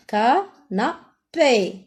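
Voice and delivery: "Canapé" slow, ca-na-pé in three beats; syllable by syllable. Shown as a demonstration. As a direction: leave gaps inside "Canapé". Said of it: "dans la troisième".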